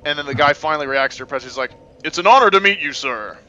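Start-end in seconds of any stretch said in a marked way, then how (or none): 2.0-3.4 loud, higher, hammed-up reverent greeting; awed admirer. Guy meeting a filthy scavenger, mock-awed proclamation. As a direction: proclaim it like a starstruck game character. Comic game character greets the wanderer.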